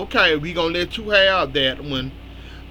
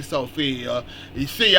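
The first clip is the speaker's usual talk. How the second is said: normal talking voice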